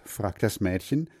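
plain reading voice

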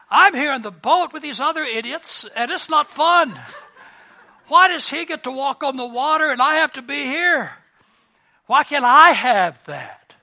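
loud, high comic griping; hammed-up outburst of unfairness; mock indignant complaint. Voices the jealous disciple complaining.

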